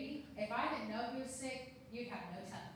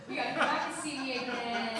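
loud, delighted send-off; excited, raised voice; excited gushing; 9 dB louder than usual. Retold or imitated. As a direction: gush it out loudly to a welcome guest. Excited parting words with gift.

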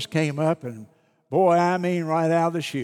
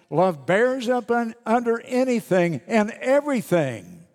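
higher, louder rising proclamation; preaching scripture; 5 semitones higher, 3 dB louder than usual. Acted. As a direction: build the verses into a proclamation. Reads scripture aloud.

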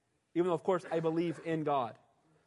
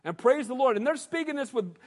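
usual speaking voice

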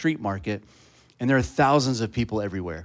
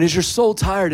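calling out; 6 semitones higher, 4 dB louder than usual. Retold or imitated. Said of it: "I just keep on".

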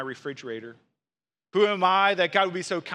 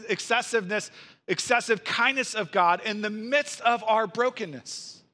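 preaching at full force; 6 semitones higher than usual. Acted. Pastor urging broken listeners toward God's kindness, impassioned preaching. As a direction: drive the good news home with rising passion. Climactic application of the sermon.

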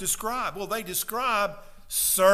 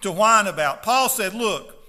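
loud, fiery preaching to the congregation; full-force preaching; 4 semitones higher, 7 dB louder than usual. Acted. Pastor urging his congregation not to whine, fiery loud preaching. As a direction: pound the message home from the pulpit.

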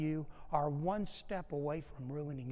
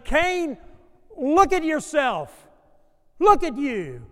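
much higher, loud, slow commanding call; God calling Cain out; 8 semitones higher, 13 dB louder, slower than usual. Acted. God loudly calling Cain to look at himself. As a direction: cry out to wake him up.

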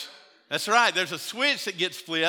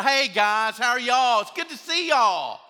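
bright, higher and louder hello; sudden sunny smile in the voice after a fight; cheery church greeting; 6 semitones higher, 6 dB louder than usual. Retold or imitated. Couple greeting friends in the parking lot, cheerful and high.

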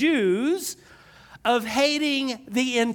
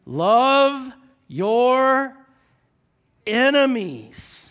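"love your enemies" drawn out slow and loud; slow emphatic scripture; read really slow; 2 semitones higher, 4 dB louder, much slower than usual. Shown as a demonstration. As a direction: stretch "love" long, pause before "enemies". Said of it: "I'm gonna read this really slow".

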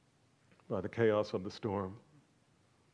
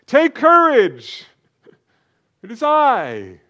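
slow, ringing shout, much louder and higher; Jesus calling out; 13 semitones higher, 18 dB louder, slower than usual. Acted. Jesus shouting over the storm to his frightened disciples, slow and loud. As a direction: call across the storm to frightened men. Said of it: "he called out to them".

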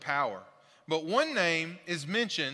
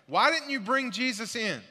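higher, louder angry question; demanding priests; 7 semitones higher, 5 dB louder than usual. Acted. Priests confront the guards, sharply raised.

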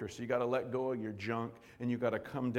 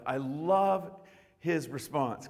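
ordinary talk

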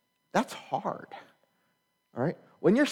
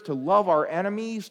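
normal talking voice

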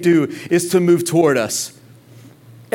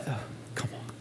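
whispered aside; 7 semitones lower, 21 dB quieter, faster than usual. Real, not acted. Preacher moved, aside to himself.

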